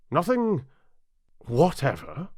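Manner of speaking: king demanding an answer in court; pressing king. Acted. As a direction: press her hard for an answer.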